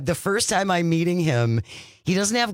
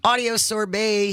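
announcer voice; 8 semitones higher than usual. Acted. Show segment jingle phrase.

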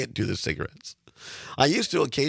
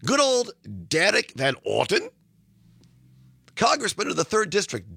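sarcastic; 6 semitones higher than usual. Real, not acted. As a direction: sneer the name with fake fondness. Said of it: "as I say that sarcastically".

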